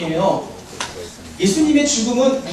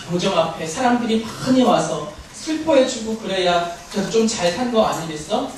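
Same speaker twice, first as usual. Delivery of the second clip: ordinary talk, nothing marked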